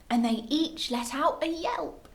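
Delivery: usual speaking voice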